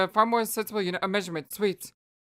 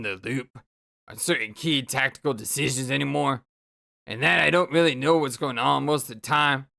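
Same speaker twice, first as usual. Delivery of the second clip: low, exasperated sarcastic rant; grumpy Karkat voice; 6 semitones lower than usual. Acted. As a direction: grumble it out like a fed-up teen troll. Karkat ranting at Vriska.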